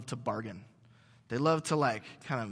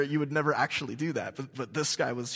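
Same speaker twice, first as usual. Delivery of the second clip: normal talking voice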